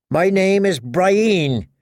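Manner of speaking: high, slow, stubborn woman's voice; dogged Brienne. A narrator's reading. Captive's guard corrects his name for her, doggedly. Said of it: "dogged as a hound".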